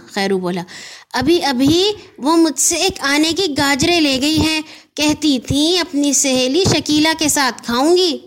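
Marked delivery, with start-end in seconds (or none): 1.1-8.2 raised, lively shopkeeper voice; a chatty corner shopkeeper; shopkeeper Khairu. Acted. Khairu the shopkeeper tells the boy, lightly voiced.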